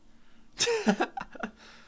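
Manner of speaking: high breathless giggle; real laugh. Real, not acted. Breaks up mid-reading.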